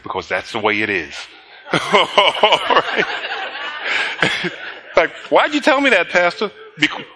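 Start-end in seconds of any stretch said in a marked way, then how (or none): 0.0-1.1 flat logical answer, a touch lower; just a hint of Spock's dry logic; low, deadpan Spock. Spock answers McCoy's question, low and deadpan. 5.3-6.5 a listener's baffled high question; higher, puzzled question. Retold or imitated. A churchgoer asks the pastor why, high and puzzled.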